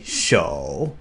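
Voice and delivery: "shou" with a strong sh, low pitch; hammered sh. Shown as a demonstration. Teacher hammers out the sh of "shou" to learners. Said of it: "really hammer out that sh".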